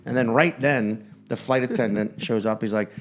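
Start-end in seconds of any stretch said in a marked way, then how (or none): none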